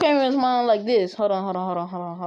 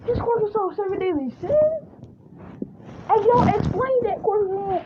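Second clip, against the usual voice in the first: high-pitched, excited questioning; acted astonishment, pitch held high; amazed onlookers; 12 semitones higher than usual. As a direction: play a stunned crowd demanding an explanation. Voicing amazed onlookers asking Deku.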